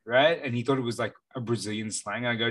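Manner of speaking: normal talking voice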